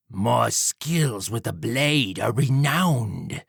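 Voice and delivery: slow, vain villain; boastful Jack. Acted. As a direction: boast slowly of your skill. Villain Jack voiced in story.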